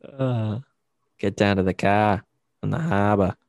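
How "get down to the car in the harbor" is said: dropped-r Boston voice; Boston accent. Retold or imitated. Mocking Boston accent of New Englanders, thick Boston. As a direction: lay on the Boston vowels. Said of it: "Boston is the worst accent".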